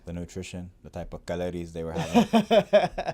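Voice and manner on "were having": laughing, pitched up. Real, not acted. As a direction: joke it with a laugh. Joke about calories, laughing.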